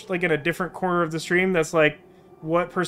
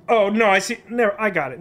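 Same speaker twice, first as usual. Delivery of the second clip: normal talking voice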